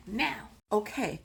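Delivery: usual speaking voice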